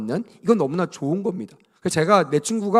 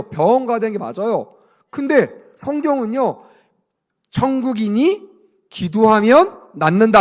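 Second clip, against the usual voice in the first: normal talking voice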